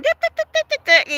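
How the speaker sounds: high sing-song mock chatter. Mocking the coworker's chatter to viewers, high sing-song. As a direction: imitate the phone girl's babble.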